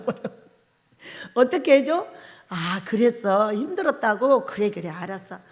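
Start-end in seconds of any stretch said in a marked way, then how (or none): 2.5-5.4 soothing husband. Shown as a demonstration. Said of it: "이렇게 해주면 돼".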